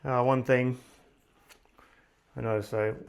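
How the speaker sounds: talking around a screw in mouth; mouth full. Real, not acted. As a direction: speak with a screw between lips. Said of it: "I got a screw in my mouth".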